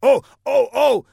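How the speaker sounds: high, loud, slow eager shout; excited shout. Acted. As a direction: blurt it like a quiz contestant. Guard excitedly answers quiz.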